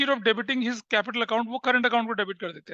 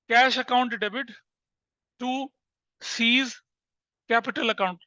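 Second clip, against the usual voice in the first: slow dictation; much slower than usual. Shown as a demonstration. Dictates the correct entry slowly.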